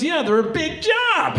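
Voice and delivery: high, slow mock retort; exasperated girlfriend. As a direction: drag it out high, as if obvious. Exasperated girlfriend in acted quote.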